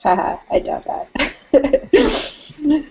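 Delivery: amused laugh through the words. Real, not acted. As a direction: laugh it off at the joke. Laughing at his age joke.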